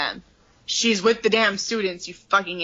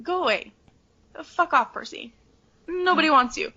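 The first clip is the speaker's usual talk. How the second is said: higher, mildly exasperated brush-off; mock dismissal; 7 semitones higher than usual. Everyone telling Percy to leave, mock annoyed. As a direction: shoo him away, annoyed. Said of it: "it's just like".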